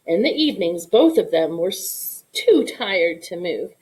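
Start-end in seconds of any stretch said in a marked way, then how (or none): none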